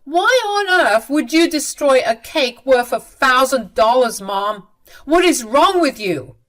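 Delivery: acted angry outburst; angry scolding. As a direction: berate your mother in disbelief. Son berates mother over ruined cake.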